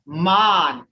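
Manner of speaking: MAN said as a long "mahn"; "Man" with an open, held AH; native vowel. Shown as a demonstration. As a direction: say Man with a drawn-out ah, unlike English. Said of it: "that looks like man in English, but it ain't".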